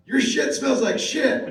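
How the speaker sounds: high, loud, slow botched quote; mock-excited shout, about to crack up; excited fan imitation. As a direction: proudly blurt it out as an overexcited fan. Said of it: "he was excited".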